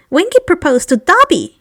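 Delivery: high, amused little elf; house elf voice. Acted. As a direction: say it with a proud elf grin.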